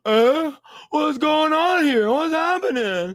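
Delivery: high, slow, drawn-out confusion; hammed-up baffled victim voice; mock bewilderment. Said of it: "he turns around and he's like".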